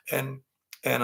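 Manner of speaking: usual speaking voice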